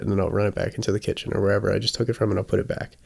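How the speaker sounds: normal talking voice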